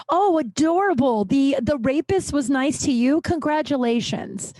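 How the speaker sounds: high-pitched fake congratulations; scathing sarcasm. Real, not acted. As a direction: gush mockingly, dripping with contempt. Mocks women who defend abusers.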